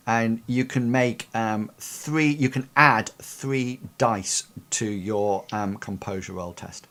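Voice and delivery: normal talking voice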